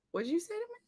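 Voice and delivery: high, soft, dangerous question; mock stern mom, suddenly high and quiet; incredulous challenge. Acting stern mom's challenge again.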